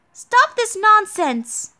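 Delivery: high, slow, forceful acted rebuke; drawn-out indignant command; scolding. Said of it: "Are you scolding me?".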